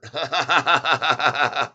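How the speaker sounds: steady run of quick chuckles; amused laugh. Real, not acted. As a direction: laugh it up, ha after ha. Laughs at chat gossip.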